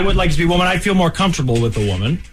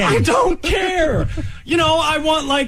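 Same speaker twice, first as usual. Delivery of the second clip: high, slow shouted denial; exasperated shout; 9 semitones higher, slower than usual. Real, not acted. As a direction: shout it over the teasing, much higher. Heated denial in argument.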